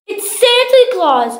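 high, loud cub's shout; excited cry. Acted. Baby Bear spots Santa in his bed, excited cry. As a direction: cry it out as a big discovery. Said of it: "cried Baby Bear".

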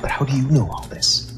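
hushed whisper of a nervous question; unnerved reporter whispering to the vampire hostess; uneasy whisper. Acted. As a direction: whisper it as if afraid to be overheard. Uneasy man in dark vampire mansion.

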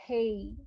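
হেই gliding clearly into i; correct pronunciation. Shown as a demonstration. Teacher gives the correct way to say hay. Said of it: "pronunciation হবে".

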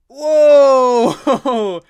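a big, high, loud cry of whoa; loud startled whoa on a bumpy ride. Acted. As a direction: yelp as the ride jolts you. Bouncy ride lurches.

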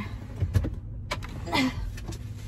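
long breathy sigh, fighting tears; high shaky sigh. Real, not acted. Alone in her car trying not to cry, sighing. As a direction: let out a sigh to keep from crying.

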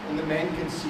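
ordinary talk

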